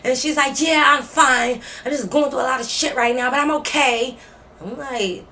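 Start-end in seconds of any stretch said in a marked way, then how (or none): 0.6-4.1 louder, higher imitation of a worked-up roommate; mock insistent. Roommate insisting to her housemate she's okay, loud and worked-up.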